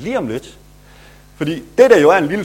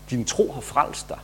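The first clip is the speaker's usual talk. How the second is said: gentle Jesus; 7 dB quieter than usual. Acted. Jesus speaks to healed woman.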